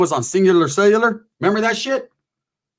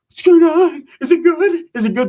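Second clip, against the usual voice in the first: mock aroused squeal; 11 semitones higher, 4 dB louder than usual. Melanie high and aroused.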